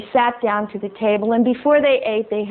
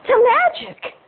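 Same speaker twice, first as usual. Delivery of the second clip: much higher, indignant squeak of the words; outraged high echo; 13 semitones higher, slower than usual. Acted. Groundhog repeats in outrage.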